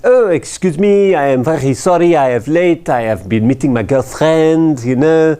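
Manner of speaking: put-on a light French accent, late and apologetic. Finance director apologizing to the meeting for being late, lightly French-accented. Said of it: "you know, it's France, after all".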